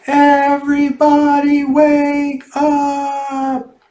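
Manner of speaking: high, very slow drawn-out call; wake-up call. Acted. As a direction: everyone hollers it long to wake Sonny. Everyone wakes Sonny in return.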